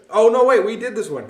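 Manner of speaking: loud, high startled catch of a mistake; oops, wrong passage; sudden realization. Real, not acted. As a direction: stop short, realizing you've skipped ahead. Catches own mistake mid-reading.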